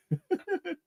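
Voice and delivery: bursts out in a high laugh; real laugh. Real, not acted. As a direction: laugh at yourself, caught off guard. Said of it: "that's funny".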